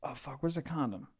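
soft, muttered alarm; half under the breath, worried; a mild mock fright; hushed retold panic. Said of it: "and I go".

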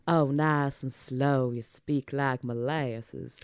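lightly slowed Southern drawl; slow Southern accent. Shown as a demonstration. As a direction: stretch it out slow like molasses. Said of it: "some Southern's like".

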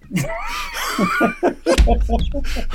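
loud, very high shrieking laugh; burst of laughter. Real, not acted. Host reading viewer's cap gun comment, shrieking laugh.

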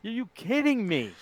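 acted disbelief. Husband waking beside the wrong bride, acted disbelief. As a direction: repeat the shock high but held back. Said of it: "can you imagine waking up the next morning?".

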